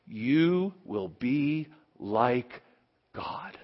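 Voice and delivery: slow, hushed serpent whisper, fading to breath; the serpent whispering to Eve, slow and hushed; tempting whisper. Acted. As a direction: lure her in slowly, barely voiced.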